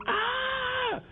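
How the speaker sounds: very high mock scream of pain; thin, high-pitched imitated shriek. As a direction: mimic a high scream without real volume. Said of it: "He's laying there screaming".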